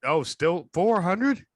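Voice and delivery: can't believe it's that cheap; incredulous. Real, not acted. Guessing old season ticket prices, slow incredulous question.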